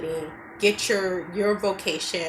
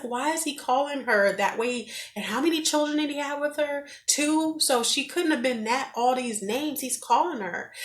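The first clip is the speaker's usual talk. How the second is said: high, indignant questions at the couple; a mild mock of her indignant self; upset outburst; 7 semitones higher than usual. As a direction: retell her own outrage, pitched up. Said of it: "I got so upset that I said something".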